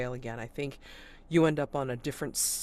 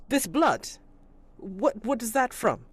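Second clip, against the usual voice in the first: higher, louder pressing question; suspicious constable; 9 semitones higher, 6 dB louder than usual. Acted. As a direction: press him about the blood. Constable questions about blood.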